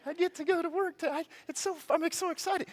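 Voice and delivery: high-pitched mock excitement; over-the-top Skippy glee. Speaker mocking anyone skippy about going to work, hammed-up excitement. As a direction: squeal it like an overeager Skippy. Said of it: "you gotta be Skippy and be like".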